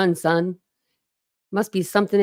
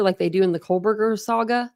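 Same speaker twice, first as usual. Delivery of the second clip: ordinary talk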